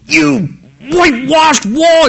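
slow, pointed mock insult at the council; a hammed-up slow rebuke. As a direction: lay the insult on slowly, half in jest. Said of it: "he's insulting people".

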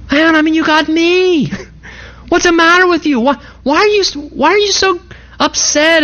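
high-pitched, baffled mock exasperation; exasperated husband. Husband asking his weeping wife what's wrong, exasperated.

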